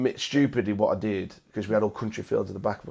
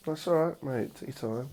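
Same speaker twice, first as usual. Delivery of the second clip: softer, hushed comfort to a crying friend; gentle reassurance; 5 semitones higher, 5 dB quieter than usual. Real, not acted. Host softly calming his guest who is close to tears, hushed and reassuring. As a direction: soothe him quietly, no rush.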